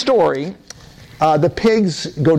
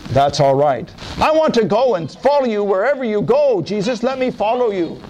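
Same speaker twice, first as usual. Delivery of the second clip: higher relieved voice; grateful healed man; 5 semitones higher than usual. Acted. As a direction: thank Jesus eagerly. Healed man thanks Jesus.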